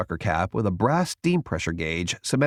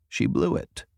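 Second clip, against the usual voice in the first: ordinary delivery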